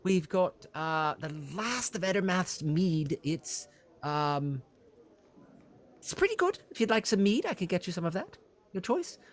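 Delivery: high, eager innkeeper voice; fussy innkeeper. Acted. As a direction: play a flustered, helpful innkeeper pitched high. Innkeeper lists drinks.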